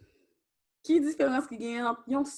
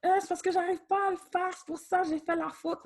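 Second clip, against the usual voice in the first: fast, higher mock whiny excuse; imitating a student's excuse; 8 semitones higher, 6 dB quieter, faster than usual. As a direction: mimic a kid making excuses, quick and pitched up. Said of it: "Pi gen moun di". (imitation rapide et aiguë d'un élève qui se justifie)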